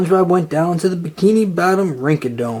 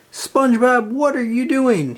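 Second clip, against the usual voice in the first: high, sharp yell; 8 semitones higher than usual. A narrator's reading. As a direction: catch him in the act. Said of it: "he yelled".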